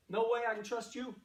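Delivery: ordinary talk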